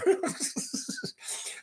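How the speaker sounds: a high airy burst of laughter; breathy high giggle. Real, not acted. Host laughing at a chat comment, high airy giggle.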